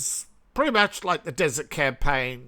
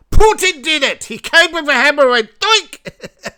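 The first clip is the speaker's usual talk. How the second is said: high, loud hammed-up accusation; hammed-up high shout, mocking the blame; mock outrage; 11 semitones higher, 10 dB louder than usual. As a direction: shout it like a comic conspiracy rant.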